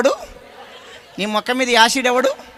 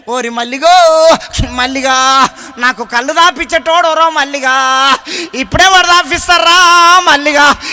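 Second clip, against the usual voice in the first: mock wailing; 3 semitones higher, 8 dB louder, slower than usual. Preacher mimics a drinker mourning his drinking friend, loud mock wailing. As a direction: howl the dead friend's name, hammed up. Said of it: "ఎట్లా ఏడుస్తాడంటే".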